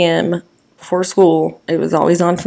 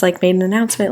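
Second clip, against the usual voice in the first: ordinary talk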